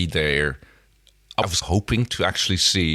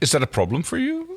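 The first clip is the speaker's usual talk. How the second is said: mock alien question; 9 semitones higher than usual. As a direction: ask it sweetly, a touch offended. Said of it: "It's like".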